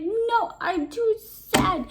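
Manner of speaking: a touch of sulky sorrow; mild sad king. Acted. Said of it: "He was so sad".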